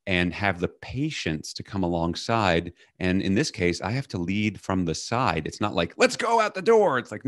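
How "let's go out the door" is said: much higher hurried command; impatient boss. Retold or imitated. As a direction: order the kid out the door. Impatient parent ordering child out.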